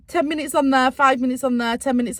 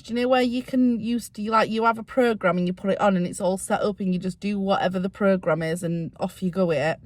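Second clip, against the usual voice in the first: ordinary delivery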